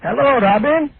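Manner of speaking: high, slow, hammed-up Indian accent from a prank caller; bad fake Indian accent. Prank caller to Howard in a bad fake Indian accent. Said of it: "embarrassingly bad fake Indian accent".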